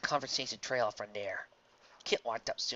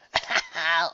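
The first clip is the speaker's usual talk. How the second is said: put-on breathless, rattling old man's laugh; 5 semitones higher, 7 dB louder than usual. Old Louis laughing at Kit, rattling laugh. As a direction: wheeze out a laugh. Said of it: "A rattling, breathless laugh".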